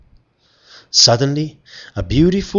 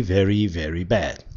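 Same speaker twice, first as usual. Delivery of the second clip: plain reading voice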